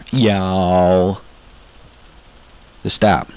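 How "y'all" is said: "y'all" stretched very long as a flat-vowelled "yaaal"; mocking, over-long drawl on "y'all"; dragged-out y'all. Shown as a demonstration. Southerner mocking bad impersonators, "y'all" dragged out endlessly. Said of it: "We don't drag it out for, like, an hour".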